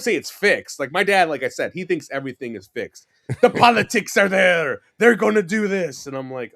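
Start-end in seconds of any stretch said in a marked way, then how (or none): 3.4-4.8 high, loud mock rant; mock outrage, voice raised; imitated angry dad. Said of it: "you'll understand his anger". 5.0-6.1 high, loud mock rant; mock outrage, voice raised; imitated angry dad. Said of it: "you'll understand his anger".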